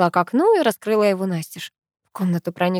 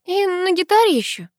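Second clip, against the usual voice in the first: very high, thin little squeak; 12 semitones higher than usual. A narrator's reading. Granddaughter lies to grandmother, squeaked. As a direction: pipe up in a high pitch. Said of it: "снова пискнула я".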